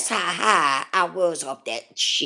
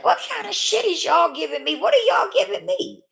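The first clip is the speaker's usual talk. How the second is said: indignant retold demand; 10 semitones higher than usual. Confronting her counselor about the medication, high and exasperated. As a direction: demand answers in disbelief. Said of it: "I said".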